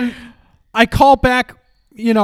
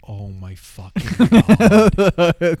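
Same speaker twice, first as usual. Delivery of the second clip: slowly moaning at the absurdity; slow exasperated groan; 2 semitones lower, much slower than usual. Retold or imitated. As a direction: drag it out slowly, half laughing in despair. Retold exasperation.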